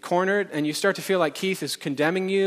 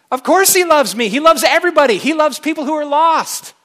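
loud, high, ringing declaration; emphatic proclamation; 9 semitones higher, 10 dB louder than usual. Real, not acted. Preacher insisting to the congregation, loud and high. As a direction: proclaim it to the whole room.

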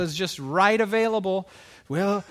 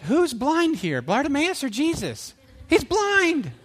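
high, exasperated mock disbelief; incredulous; 8 semitones higher, slower than usual. Listener questioning Jesus about the blind man, mock incredulous. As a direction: push the pitch right up, baffled by the obvious.

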